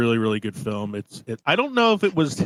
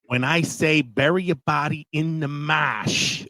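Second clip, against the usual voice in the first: slow, higher Boston accent imitating Jack Nicholson; 4 semitones higher, slower than usual. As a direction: lean into a mock Boston accent like a gangster. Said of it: "what he's doing with that Boston accent".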